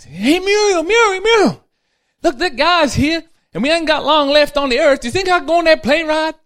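put-on high, loud, eager old husband; old husband begging his wife for the plane ride, high and loud; pleading old man. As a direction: beg your wife like an excited old man.

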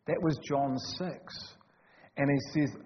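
normal talking voice

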